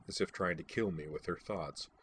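ordinary delivery, nothing marked

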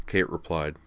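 usual speaking voice

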